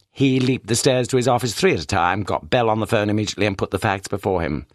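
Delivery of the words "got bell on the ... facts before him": ordinary delivery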